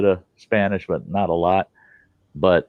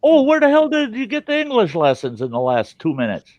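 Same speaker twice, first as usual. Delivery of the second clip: higher, sarcastic disbelief; mock incredulous; 12 semitones higher than usual. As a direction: needle him with pitched-up sarcasm. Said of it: "I go".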